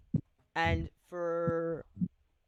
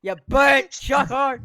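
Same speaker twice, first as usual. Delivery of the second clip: much higher, louder, slow mocking taunt; teasing jab; 9 semitones higher, 14 dB louder, slower than usual. Real, not acted. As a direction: drag out the insult to needle him. Teasing insult at friend.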